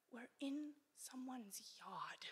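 slow, hushed whisper; alarmed whisper. Retold or imitated. Brother realizing they're in a yard, slow whisper. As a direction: whisper the bad news slowly. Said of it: "Daniel just goes".

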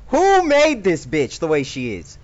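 loud, high furious rant; raging gamer. Real, not acted. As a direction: rage at the screen. Raging at game character.

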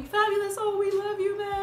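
loud, high-pitched adoring yell; imitating screaming fans. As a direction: cry out like a crowd of adoring fans. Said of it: "everyone starts screaming".

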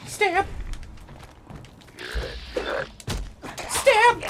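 squeaky high battle cry; high-pitched stab. Acted. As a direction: shriek it way up high. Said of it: "I can still do the high-pitched ones".

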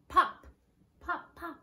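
high popping sounds; cork pops. Shown as a demonstration. Teacher pops like a cork for the kids. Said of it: "Can you do that?".

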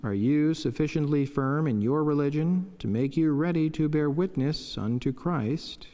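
ordinary talk

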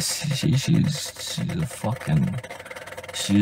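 hushed unvoiced whisper; whispering about the car behind its back. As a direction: whisper it so she can't hear. Muttering about the car nearby.

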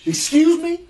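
high indignant excuse me; offended. Acted. Cat offended by the dog's insult, high and indignant. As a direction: rise up in offended shock.

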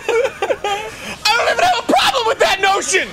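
high, strained protest; hammed-up kid pretending not to be upset; mock tantrum. Friend playing the punished son, hammed-up high protest.

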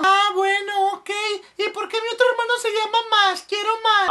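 put-on child's voice. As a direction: ask like a curious kid. Child asking dad.

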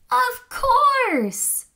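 high, bright eureka cry; excited realization. Acted. Scout realizing the missing ingredient, excited. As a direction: shout it like a sudden idea.

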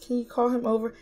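ordinary delivery

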